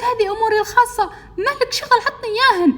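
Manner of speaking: put-on very high, loud girlish protest; acted shrieky outrage in a quarrel; indignant demand. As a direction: squeal at him to hand them over. Crying girl demands photos back.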